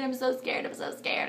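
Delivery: breathless frightened whisper; scared whisper. Retold or imitated. To her mother during labour, a frightened whisper. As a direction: let it fade into a scared whisper. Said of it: "I kept telling everybody I was so scared".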